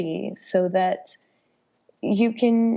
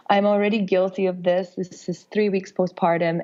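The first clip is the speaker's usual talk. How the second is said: usual speaking voice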